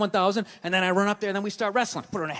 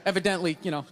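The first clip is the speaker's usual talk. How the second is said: ordinary talk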